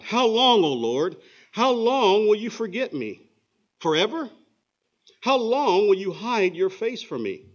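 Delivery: loud, high, slow desperate plea; David in despair calling out loudly and slowly to God; crying out. Acted. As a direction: call out to God from the darkest moment. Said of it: "David cries out to God".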